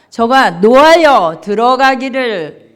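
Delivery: loud, firm scripture reading. Real, not acted. Congregation reading the verse aloud, loud. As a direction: read it out loud together.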